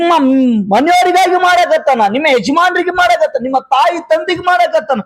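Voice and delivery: rousing sermon delivery, rising and driving; fervent preaching. Real, not acted. Pastor preaching to the congregation about God's gifts, fervent. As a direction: preach it to the believers with conviction.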